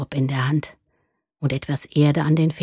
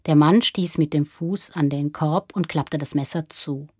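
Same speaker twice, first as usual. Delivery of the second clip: plain reading voice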